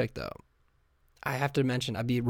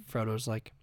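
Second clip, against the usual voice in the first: normal talking voice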